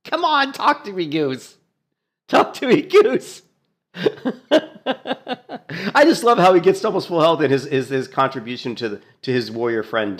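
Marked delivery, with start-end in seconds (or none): 0.0-3.4 high, urgent mock Top Gun yell; imitating the healer shouting at Maverick. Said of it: "She's probably, like, killing him to say". 4.0-5.9 laughing. Real, not acted. Laugh alone.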